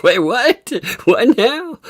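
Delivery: hammed-up startled double take; mock surprise. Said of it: "He's like".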